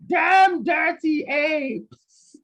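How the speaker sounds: high, very slow, stretched shout; hammed-up film line. Host rehearsing the bit he forgot, hammed-up outburst. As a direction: stretch out each word as an overblown movie quote. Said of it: "memorize that whole".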